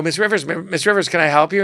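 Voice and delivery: mock servile offer of help; obsequious. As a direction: imitate an over-eager assistant trailing a star. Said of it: "be obsequious".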